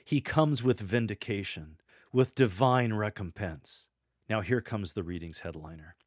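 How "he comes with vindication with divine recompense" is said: strong, raised reading voice; powerful proclamation. Shown as a demonstration. Lector proclaiming vindication to the congregation, with power. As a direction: keep the power up, phrase by phrase. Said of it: "Continue with the same power in your voice".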